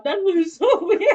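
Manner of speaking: laughing tease. Real, not acted. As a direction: giggle through the teasing. Giggling over a near fall.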